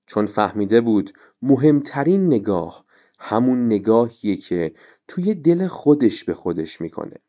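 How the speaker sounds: ordinary delivery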